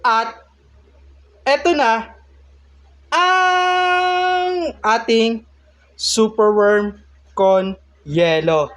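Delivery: rising, high, long-drawn announcer build; dramatic reveal. Acted. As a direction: stretch it out like a big unveiling. Grand reveal of the finished dish.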